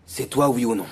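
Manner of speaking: loud, pressing demand for an answer. Acted. Friend confronting the accused man, loud and pressing. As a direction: force him to answer.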